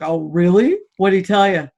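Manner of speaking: loud sarcastic disbelief; mock-skeptical, loud and pointed. Client to counselor, loud sarcastic disbelief. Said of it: "I looked at him like".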